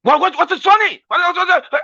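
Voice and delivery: high-pitched, hammed-up outburst; mock angry. Comedian acting touchy people offended by laughter, mock angry. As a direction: fire off repeated questions in sudden pretend outrage. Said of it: "they will become angry".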